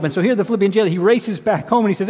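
normal talking voice

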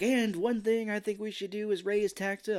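orate in a put-on voice, losing the thread; distracted speechmaker. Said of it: "he basically forgets what he's saying".

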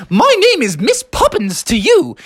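high, prim English nanny; Mary Poppins. Acted. Nanny correcting a familiar young lad, high and prim. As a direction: correct him primly, pitched high.

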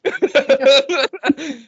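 laughs through the word shake; real laughter; laughing. Real, not acted. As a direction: giggle the command out. Repeating the command while laughing.

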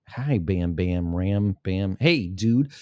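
ordinary talk, nothing marked

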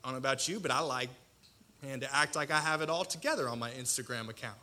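ordinary talk

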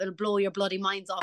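usual speaking voice

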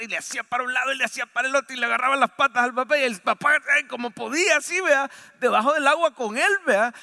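put-on storyteller reliving the boy's panic; excited struggle telling. A narrator's reading. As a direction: tell the thrashing breathlessly fast. Vivid struggle narration.

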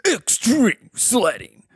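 high, slow, stretched-out billing; big showy sign read, pitched high; hyped sign announcement. Acted. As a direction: draw it out like an event announcer. Game master reads out big sign.

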